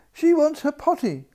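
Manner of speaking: maid crying out. A narrator's reading. Maid shouting the news through the castle, loud and high. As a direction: raise the alarm up high. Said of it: "cried the maid".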